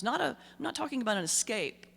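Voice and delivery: ordinary talk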